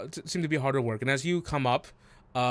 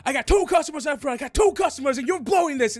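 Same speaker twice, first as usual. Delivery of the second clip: high, loud, fast tirade; angry scolding; 13 semitones higher, 6 dB louder, faster than usual. Acted. Kitchen boss berates a worker over waiting customers, angry. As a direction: chew someone out in a rush.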